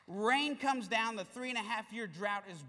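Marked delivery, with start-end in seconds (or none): none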